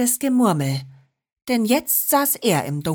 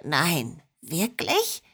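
softer, breathless disbelief; quietly amazed, almost unvoiced at the end; hushed surprise; 3 semitones lower, 4 dB quieter, slower than usual. A narrator's reading. Schorsch finds something glowing in his pocket, hushed amazed disbelief.

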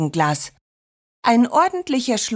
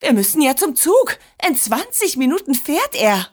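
higher, urgent announcement; alarmed urgency; 5 semitones higher than usual. A narrator's reading. Grandmother realises the train leaves soon, alarmed. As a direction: raise the pitch in a panic.

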